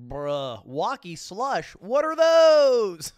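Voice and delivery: slow, drawn-out mock yell on the last words; imitating a shout. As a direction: parody the clip, dragging out the final shout. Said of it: "She's up there going".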